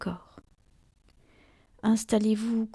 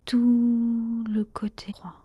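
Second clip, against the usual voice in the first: drawn-out meditation phrase; slower than usual. Real, not acted. Guide closing the right side of the body, slow drawn-out "Tout". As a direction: TOUT held long and slow, then settling.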